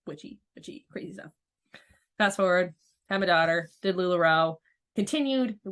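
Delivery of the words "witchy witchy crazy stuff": spooky whisper. Retold or imitated. Mocking her old view of witchcraft.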